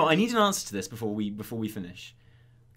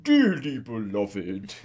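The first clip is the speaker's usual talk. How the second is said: gruff officiant; 5 semitones lower, 6 dB louder, much slower than usual. Retold or imitated. Tusker officiant impression.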